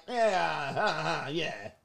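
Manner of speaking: very soft, half-laughed yeah; mock awkward girl. Girl avoiding a close hug, mock awkward and soft. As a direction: imitate a quiet, uneasy brush-off laugh.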